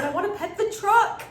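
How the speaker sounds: put-on high, loud, thrilled little-kid voice; excited toddler. Mom imitating her son begging to pet the truck, high and thrilled. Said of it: "and he's so excited".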